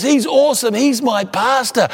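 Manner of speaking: high, emphatic bragging; imitating loud talker. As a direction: mimic someone proudly showing off a friend. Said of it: "talk at one volume loud".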